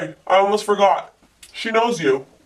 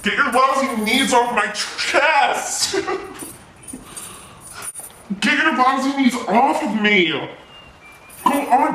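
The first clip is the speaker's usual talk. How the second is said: raised, strained insults while pinned down; angry struggling yell; 5 semitones higher, slower than usual. Acted. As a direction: shout up at the one holding you down.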